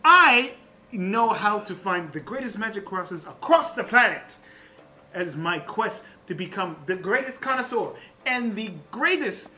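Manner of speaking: grand sales pitch with punched superlatives; boastful connoisseur. Acted. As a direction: sell your magic empire like a showman. Plays boastful Louis Lightsword.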